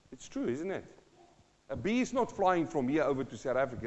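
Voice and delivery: normal talking voice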